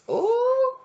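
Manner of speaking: high, loud ooh of surprise; a sudden squeal of delight; surprised ooh. Real, not acted. Reacting to a drawn card.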